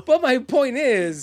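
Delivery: loud, high, very slow insistence; really belabouring it at full voice; emphatic shout. Real, not acted. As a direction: stretch each word, shouting over interruptions. Repeats point over interruptions.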